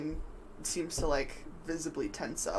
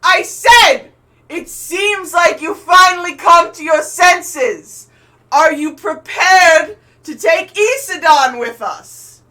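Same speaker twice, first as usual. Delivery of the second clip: high, slow villain's bellow; grand drawn-out yell to another ship; shouted repeat; 12 semitones higher, 19 dB louder, slower than usual. Acted. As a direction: stretch each phrase loudly across the water. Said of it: "And he goes".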